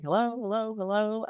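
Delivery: calling out. Retold or imitated. Agent walking room to room in a house, calling out. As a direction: call through the house for anyone there.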